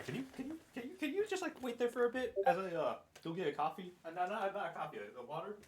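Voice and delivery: faint, high-pitched, halting ask; off-mic request. Real, not acted. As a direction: call it distantly while heading off for a drink. Stepping away to fetch a drink.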